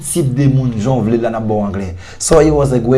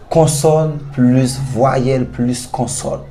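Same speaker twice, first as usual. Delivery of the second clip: consonne plus voyelle plus consonne, each word drawn out very slowly; slow spelled-out rule; much slower than usual. Shown as a demonstration. Teacher stressing the rule to the class, slowly. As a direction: lay out the pattern piece by piece. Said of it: "qui gagne une seule syllabe".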